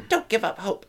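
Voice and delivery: high-pitched panto voice; hammed-up audience call, kept high; mock panto encouragement; pantomime plea. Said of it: "a pantomime's like".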